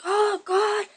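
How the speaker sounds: mock dying plea. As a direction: ham up being shot.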